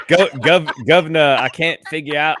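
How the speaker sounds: put-on loud, stumbling stab at a British accent; attempted British accent. Host pushed to sound British, faltering British accent. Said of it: "in a British accent".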